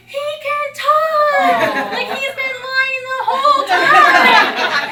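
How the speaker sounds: high, loud, slow excited squeals; a class bursting with delight; shrieking kids. Retold or imitated. Kindergartners cheering a silent classmate, squealing with joy. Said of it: "the class goes crazy".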